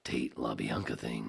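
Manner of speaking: low, slow announcer delivery; low announcer take. Acted. Host restarting the phrase as a promo, low and slow. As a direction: drop it down and draw it out. Same words dropped into low voice.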